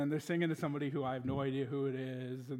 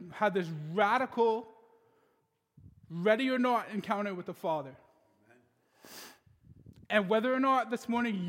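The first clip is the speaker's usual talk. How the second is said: raised, emphatic sermon delivery; full preacher's cadence; preaching; 4 semitones higher, 6 dB louder than usual. Acted. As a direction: preach it to the congregation with conviction.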